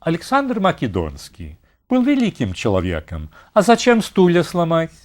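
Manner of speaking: a mild Russian-speaking inspector's reproach; Russian official scolding. Retold or imitated. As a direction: quote him in Russian, gently chiding. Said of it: "he said".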